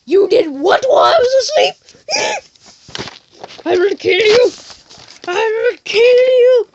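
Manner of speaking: hammed-up high-pitched furious shout; enraged outburst. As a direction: shriek it like a soap-opera threat. Acted outburst on waking from coma.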